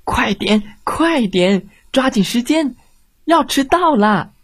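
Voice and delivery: mock-cross mother, pitch climbing high; angry hurrying. A narrator's reading. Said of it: "很生气地说".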